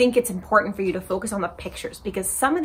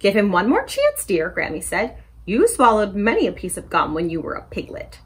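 put-on lively picture-book read-aloud for kids; story time reading; 3 semitones higher, 6 dB louder than usual. A narrator's reading. Librarian reading a story to children, animated. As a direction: read it bright and animated to little listeners.

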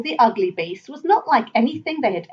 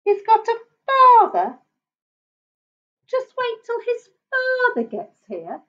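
high-pitched, dawning alarm; slowing, high-voiced disbelief; slow realisation; 13 semitones higher than usual. A narrator's reading. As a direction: let it sink in, pitched high. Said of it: "said Basil slowly".

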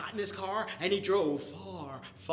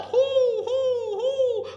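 high, loud owl hoots with a laugh; owl hooting; 9 dB louder, much slower than usual. Retold or imitated. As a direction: hoot like an owl in the night. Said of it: "And the owl".

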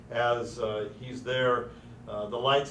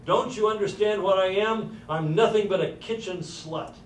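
retold scream; 7 semitones higher, 5 dB louder than usual. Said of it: "she screams back to him".

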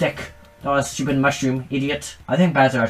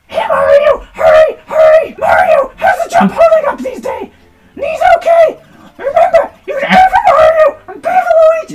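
very high, loud squeaky cheering; Toad voice; 10 dB louder than usual. Acted. Toad cheering Mario on in battle, squeaky shouts. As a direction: shout helpful tips like an excited Toad. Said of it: "Should I do Toad voice acting?".

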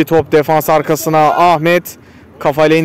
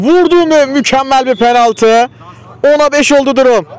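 raised, excited penalty call; excited commentator; 7 semitones higher than usual. Real, not acted. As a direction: lift the voice as the penalty goes in. Penalty scored, live commentary.

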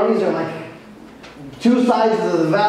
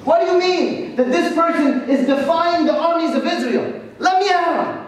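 put-on much higher, eager cocky boy; itching to fight the giant; eager boast; 9 semitones higher than usual. David indignant at Goliath.

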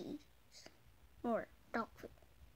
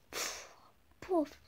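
ordinary talk

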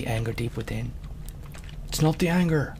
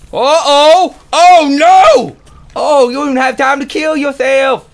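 much higher and louder yelling; real alarm, high and loud; panicked alarm; 13 semitones higher, 15 dB louder than usual. Real, not acted. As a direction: shout as the creeper blows. Creeper about to explode.

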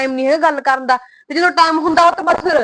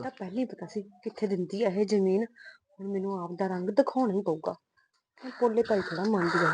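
much softer, scheming under her breath; hushed grumble; 6 semitones lower, 14 dB quieter than usual. Acted. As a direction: grumble low so no one hears. Wife grumbles privately to husband. (ਪਤੀ ਨੂੰ ਹੌਲੀ ਆਵਾਜ਼ ਵਿੱਚ ਸ਼ਿਕਾਇਤ)

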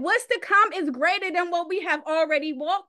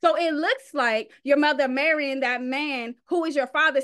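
ordinary talk, nothing marked

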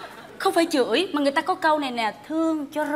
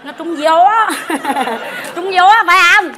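laughing; 3 semitones higher, 8 dB louder than usual. Real, not acted. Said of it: "laughing mockery".

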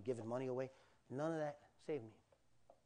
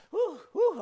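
high, loud whooping breaths; acted gasp for air; 13 dB louder than usual. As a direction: whoop as if surfacing from underwater. Acts out coming up for air.

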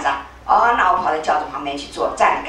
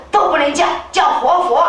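emphatic insistence; 8 semitones higher, 3 dB louder than usual. Retold or imitated. King insists on title.

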